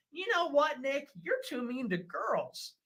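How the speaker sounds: high, put-on scolding of a naive critic; mocking critic voice. Mimicking newcomers scolding Nick, high mocking voice.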